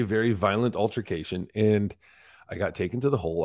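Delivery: usual speaking voice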